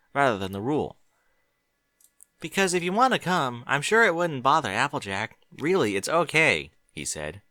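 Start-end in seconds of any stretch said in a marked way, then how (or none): 2.4-6.7 put-on higher, eager young mare voice; Twilight's voice. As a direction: ask him kindly and brightly.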